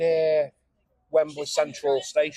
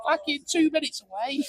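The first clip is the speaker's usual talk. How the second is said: high, badly faked Cockney; Cockney accent; 13 semitones higher than usual. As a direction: imitate a Cockney tout, pitched way up. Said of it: "can't do an accent".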